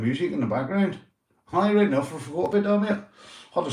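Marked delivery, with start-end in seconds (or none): none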